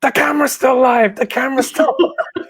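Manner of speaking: a mock panicked shout from behind camera; screamed warning. Crew member warning the host on air, mock yell. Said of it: "someone ... just screams".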